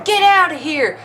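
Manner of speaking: high, mock-outraged crowd yell; throwing a fit. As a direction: imitate angry people stuck at the back. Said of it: "were throwing a fit".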